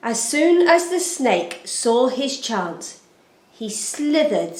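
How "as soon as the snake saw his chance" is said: plain reading voice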